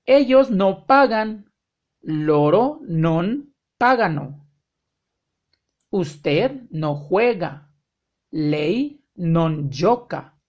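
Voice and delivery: very slow, carefully spaced model phrases; slow example sentences. Shown as a demonstration. Teacher modeling negative sentences, very slow and clear. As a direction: say each word slowly so learners can copy. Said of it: "model sentences".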